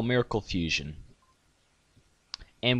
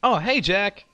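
eager high voice; 8 semitones higher, 5 dB louder, slower than usual. Acted. Voices character greeting Jack.

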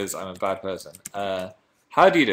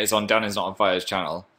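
ordinary delivery

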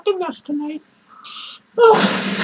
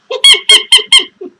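shrill clown cackle; 11 dB louder than usual. Acted. Clown laughs after Garfield.

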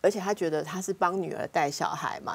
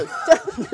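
high, loud, breathy laugh; real laugh at her own story; burst of laughter; 11 semitones higher, 7 dB louder than usual. Real, not acted. Laughs at forced act.

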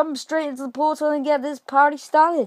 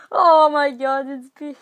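laughing; 4 semitones higher, 4 dB louder, slower than usual. Real, not acted. After knocking a player off.

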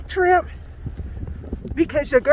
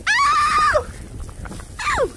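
startled cry. Real, not acted. Wind hits while walking.

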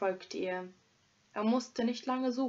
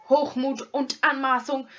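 louder, raised rebuke; shouting back; 3 semitones higher, 7 dB louder than usual. Acted. Hermit shouting back at the robber, furious. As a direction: yell it back at him. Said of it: "schrie der Eremit zurück".

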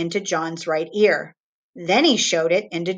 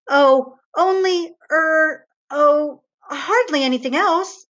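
put-on higher, hesitant boy voice, stalling; a guilty little boy fumbling for an excuse; boy caught out; 8 semitones higher, 4 dB louder than usual. John hedging to father, caught.

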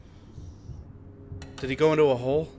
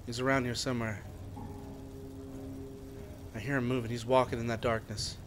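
hushed voice; low and careful, not to be heard; 3 semitones lower, 9 dB quieter than usual. Real, not acted. Hiding from a roaming monster.

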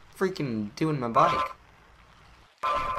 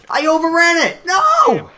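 much higher, much louder cry of dismay; dismayed shout; 15 dB louder than usual. Real, not acted. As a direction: scream at the mistake.

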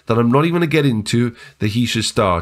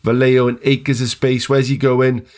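ordinary talk, nothing marked

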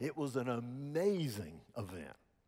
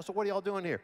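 higher curious waiter voice; waiter asking; 6 semitones higher, 3 dB louder, faster than usual. Retold or imitated. Preacher voicing a New York waiter, curious and higher. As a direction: play the waiter, intrigued.

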